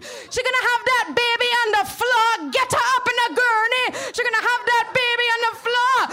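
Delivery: loud, high Caribbean nurse voice; Caribbean accent. Retold or imitated. Nurse from the island yelling to get a gurney. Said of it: "she's from, like, the island".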